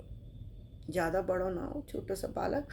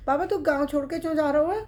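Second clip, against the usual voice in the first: put-on child's question; 5 semitones higher, 7 dB louder, faster than usual. Boy voice asking.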